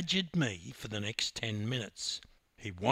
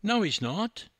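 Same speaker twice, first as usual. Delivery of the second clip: higher, quick protest; 8 semitones higher, 3 dB louder than usual. Acted. Teammate defending the swimmer, protesting. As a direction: object in his defence, pitched up. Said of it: "protested Lara".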